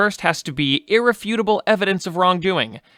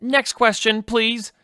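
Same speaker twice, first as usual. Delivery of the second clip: high, exasperated scolding; fed-up official, voice pitched well up; 7 semitones higher, slower than usual. Acted. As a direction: lose patience and shut the questioner down. Spokesman fed up with flirting questions.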